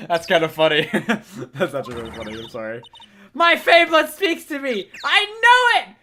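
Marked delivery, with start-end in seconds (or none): 3.3-6.0 high, loud and stretched; rally everyone to grab her; frenzied battle cry. Acted. Voicing frenzied Maya.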